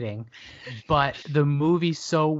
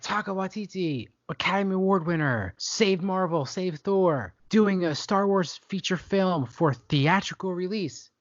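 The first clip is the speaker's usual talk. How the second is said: mock-grand announcer, pitched higher; hyped announcement; 5 semitones higher than usual. Host mimicking a studio's film announcement, mock-grand and higher. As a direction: announce each credit like a big billing. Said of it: "they were like".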